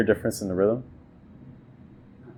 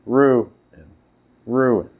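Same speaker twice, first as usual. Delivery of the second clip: "Ruin" with RU loud and falling; stress on RU; 3 semitones higher, 4 dB louder than usual. Shown as a demonstration. Teacher showing the student that ruin is a trochee. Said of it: "Ruin is more of a trochee".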